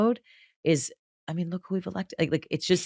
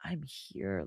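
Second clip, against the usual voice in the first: reassuring; 10 dB quieter than usual. Retold or imitated. Comforting a crying friend.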